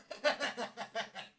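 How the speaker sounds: breaking into a chuckling laugh; laughter. Real, not acted. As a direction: laugh at your own joke. Laughs after his mock begging.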